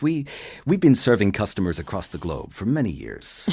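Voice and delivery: transatlantic corporate read, lightly British; kind-of-British accent. Acted. Voice actor showing his transatlantic corporate read, kind-of-British accent. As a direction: read it like a globe-spanning brand, half-British. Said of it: "he's kind of British".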